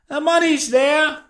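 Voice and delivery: high, slow call from a window. A narrator's reading. Man at the window answering blind leader, high slow call.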